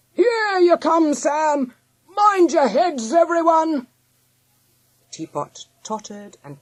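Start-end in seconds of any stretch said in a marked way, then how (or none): 0.1-3.9 high, loud, drawn-out shouts; slow, stretched rallying call; leader calling out. A narrator's reading. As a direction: holler a warning to the crowd. Leader calling warnings to all.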